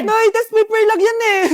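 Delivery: panicked loud excuse; loud pleading. Acted. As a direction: plead that it's only a test. Child pleads with his angry mother.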